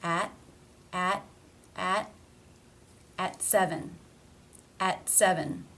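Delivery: "At" with the final T held unreleased; stop T. Shown as a demonstration. Teacher showing a word-ending T, stopped in "at seven". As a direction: drill "at" alone, then "at seven", stopping the T before the S. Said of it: "it's a stop".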